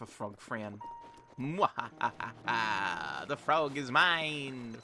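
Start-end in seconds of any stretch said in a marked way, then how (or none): 1.4-3.3 slow drawn-out evil laugh; evil villain cackle. Acted. Gloating over the Frog Fran. 3.3-4.8 sneering panto villain. Acted. Gloating over the Frog Fran.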